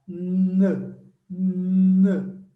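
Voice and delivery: N as a loud hummed nnn through the nose; nasal N sound. Shown as a demonstration. Teacher showing the nasal sound of N, hummed nnn. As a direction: hum N through the nose, held. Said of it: "É como se fosse um nasal".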